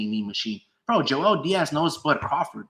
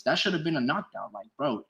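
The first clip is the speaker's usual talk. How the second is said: normal talking voice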